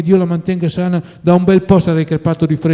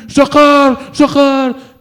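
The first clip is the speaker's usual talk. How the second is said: ghostly call; 10 semitones higher, 6 dB louder, much slower than usual. A narrator's reading. Imagined voice calling from grave.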